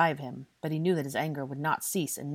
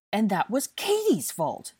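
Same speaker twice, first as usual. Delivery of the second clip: loud, high, slow emphatic protest; heated, drawn-out objection; 6 semitones higher, 6 dB louder, slower than usual. A narrator's reading. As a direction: protest the blame indignantly. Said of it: "Draco protested".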